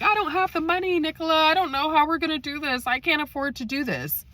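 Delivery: imitating mom. Mom crying poor about the callback fee, whiny and high. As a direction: play her up as a put-upon, pleading mom.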